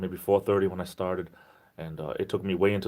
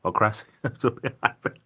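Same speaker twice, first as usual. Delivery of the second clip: laughing; 3 semitones higher than usual. Real, not acted. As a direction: repeat it aloud with a laugh breaking in. Repeats the name, laughing.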